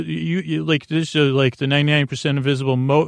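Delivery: ordinary delivery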